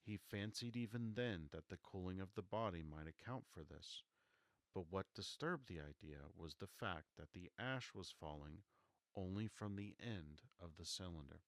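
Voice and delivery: ordinary delivery, nothing marked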